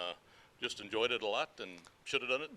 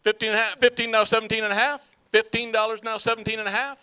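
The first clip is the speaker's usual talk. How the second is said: louder rhythmic auction chant; auctioneer; 6 semitones higher, 14 dB louder than usual. Acted. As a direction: roll the numbers in a chant. Auction chant, third item.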